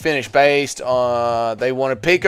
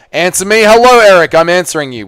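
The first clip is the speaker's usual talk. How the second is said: louder, higher call to get Eric's attention; mock exasperated shout; 6 semitones higher, 7 dB louder than usual. Streamer answering a pestering viewer, in mock exasperation. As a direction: raise your voice as if badgered for an answer.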